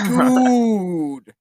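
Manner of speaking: high, long, drawn-out shout; exasperated yell. Real, not acted. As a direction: wail the curse in loud disbelief. Game master reacts to the roll.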